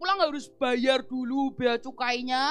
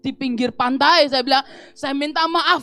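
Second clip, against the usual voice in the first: louder pleading prayer to God; loud earnest prayer; 11 dB louder than usual. Retold or imitated. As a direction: cry out the apology to God. (doa keras dan sungguh-sungguh)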